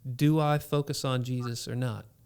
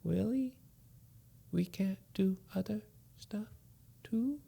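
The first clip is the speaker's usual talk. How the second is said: put-on high, hushed kid's voice; calmed son asking his father, small and soft; small child asking; 6 semitones higher, 8 dB quieter than usual. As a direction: ask sadly like a little boy. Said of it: "He's like".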